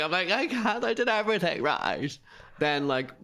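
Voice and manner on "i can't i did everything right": high, whiny retold wail; mock bawling. Guest retelling positive test at Christmas, mock bawling. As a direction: wail it like a hard-done-by child. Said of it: "I start bawling".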